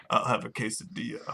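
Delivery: very low, very soft deadpan croak; rocker bass player voice. Retold or imitated. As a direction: mumble it like a bored rock bassist. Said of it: "he's like".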